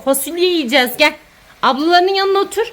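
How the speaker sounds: usual speaking voice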